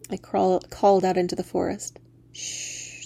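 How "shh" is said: soft breathy hush; whispered shush. Acted. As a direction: hush her gently. Fairy hushing the crying woman.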